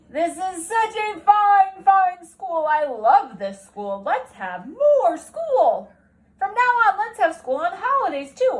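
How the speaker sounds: an ecstatic principal announcing to the whole school; booming principal. Acted. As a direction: proclaim it like an overjoyed headmaster.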